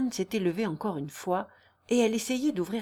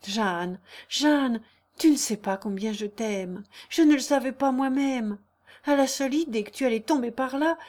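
higher, impassioned declaration; acted breathless emotion, pitched up; overwrought lover; 5 semitones higher, 5 dB louder than usual. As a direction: pour out love after a scare. Léon's overwrought love declaration.

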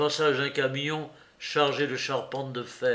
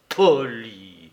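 pitiful dismay; 4 semitones lower, 7 dB louder than usual. Acted. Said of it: "un navré pitoyable".

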